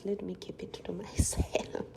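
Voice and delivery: soft whispered aside; hushed private aside. Real, not acted. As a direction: whisper it to yourself.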